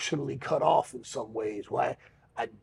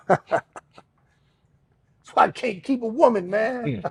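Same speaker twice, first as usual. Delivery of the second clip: rueful laugh, pitched up; laughing through pain; 8 semitones higher, 6 dB louder than usual. Real, not acted. Guest joking about losing women, laughing ruefully. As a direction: laugh it off but let the hurt show. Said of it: "I'm laughing, but I'm crying".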